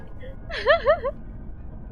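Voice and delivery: very high squealing giggle; high squeaky giggle. Real, not acted. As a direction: squeal with laughter. Laughs about banana.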